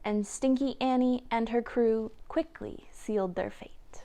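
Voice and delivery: soft ominous whisper. A narrator's reading. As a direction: hush it like a dark secret. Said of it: "whispered narration".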